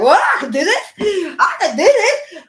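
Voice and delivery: high, loud, slowed laughing cry; triumphant. Retold or imitated. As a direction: savour the victory. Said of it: "I was like".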